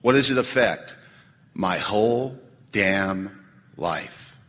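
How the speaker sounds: low, measured, with weighty pauses; reading written inventory. Acted. Speaker reading out his resentment at his father, low and deliberate. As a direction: read it out starkly, slowing and dropping to the end. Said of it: "This is how much I wrote".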